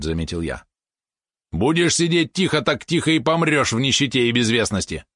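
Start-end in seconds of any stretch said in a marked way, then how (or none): 1.5-5.0 high, emphatic retort; uncompromising yap. A narrator's reading. Valeron dismisses the cautious advice, high and uncompromising. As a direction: yap it out, allowing no argument. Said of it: "бескомпромиссно тявкнул Валерон".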